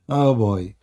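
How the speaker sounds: ordinary talk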